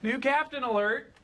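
calling out. Real, not acted. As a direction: raise the alarm to the room. Warning colleagues boss arrives.

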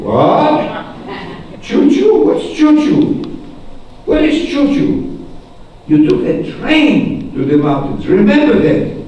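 loud, high, appalled teacher; mock indignation. Teacher correcting first grader's baby talk, mock indignant. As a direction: pounce on the word in acted disbelief.

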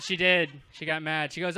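usual speaking voice

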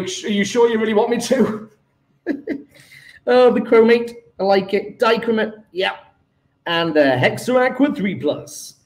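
usual speaking voice

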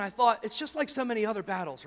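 ordinary talk

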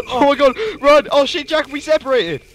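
high, loud panicky yelling; laugh-scream in panic; panicked laughing shout. Real, not acted. Panicked flight with friend.

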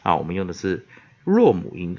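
ordinary talk

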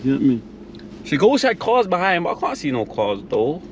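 ordinary delivery

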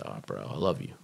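low, soft impression of a bro; mock-mellow low "bro" voice; brother's voice. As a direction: voice him low and quiet, affectionately. Retold brother's affectionate words.

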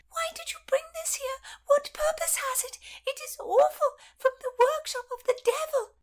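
put-on high, breathless whispered shriek of a frightened old lady; hammed-up high panicked old woman. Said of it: "Lady Paget said through her hand".

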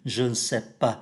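"Je ne sais pas" with the n merged into the syllable before it; "Je ne sais pas" said as "j'n'sais pas"; e dropped in ne. Shown as a demonstration. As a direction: swallow the e in "ne". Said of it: "nhập vào cái âm tiết đi trước".

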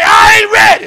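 loud call of "I ain't ready"; shouted confession. Shown as a demonstration. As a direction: belt out "I ain't ready" for the crowd to repeat.

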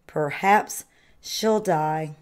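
ordinary delivery, nothing marked

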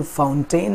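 "फाउंटेन" ending in a full "tain"; mispronunciation demo. Shown as a demonstration. Teacher showing what to avoid, "फाउंटेन" as fown-TAIN. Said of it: "ना बोल करके".

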